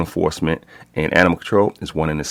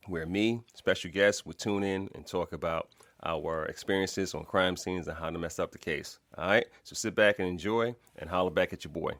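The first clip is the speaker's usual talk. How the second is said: podcast intro; 11 dB quieter than usual. Acted. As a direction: ease into the show opening.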